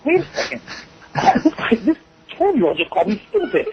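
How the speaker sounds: high-pitched, indignant retort breaking into laughter; laughing mock outrage; mock disbelief. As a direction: retell it incredulous and amused, voice jumping up. Retold outrage at child's insult.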